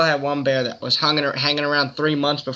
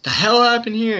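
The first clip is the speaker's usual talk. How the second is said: higher, a bit louder, startled; uncle's startled outburst; 8 semitones higher, 4 dB louder than usual. Retold or imitated. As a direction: act out the shocked uncle finding the mess. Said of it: "he's like".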